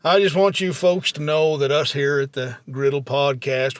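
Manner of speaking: ordinary delivery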